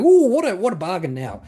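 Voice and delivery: high, loud squeal of delight at a bargain; excited buyer. Retold or imitated. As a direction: gush like a bargain hunter who can't believe the price. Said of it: "there's gonna be excited people going".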